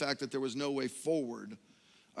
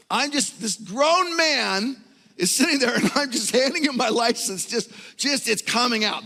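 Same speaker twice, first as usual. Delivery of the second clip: high, louder tearful telling; retold bawling; 9 semitones higher, 9 dB louder than usual. Recalling breaking down before the cop, half crying. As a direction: let the voice climb like tears are coming. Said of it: "Kurt bawling illustration".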